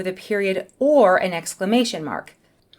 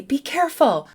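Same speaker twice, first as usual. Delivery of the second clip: higher-pitched, urgent caution; urgent warning; 7 semitones higher, 3 dB louder than usual. Shown as a demonstration. Teacher showing how an exclamation mark sounds, urgent. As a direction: warn as if it ends in an exclamation mark. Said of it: "there's a sense of urgency".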